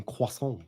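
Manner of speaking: "croissant" with a nasal French ending, drawn out; pointedly Frenchified "croissant", final t dropped; French-style croissant. Shown as a demonstration. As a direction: say "croissant" as kwah-son, silent final t. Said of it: "You said croissant".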